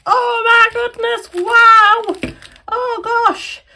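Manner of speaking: overjoyed gasping oh my goodness; excited exclamation. Real, not acted. Opening a viewer's parcel, loud delight. Opening Happy Mail package.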